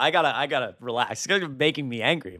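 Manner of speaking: ordinary talk, nothing marked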